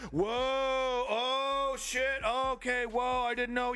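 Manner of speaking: high, drawn-out mock shock; mock surprise. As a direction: play the scared tough guy, stretched out. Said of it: "and then we're all like".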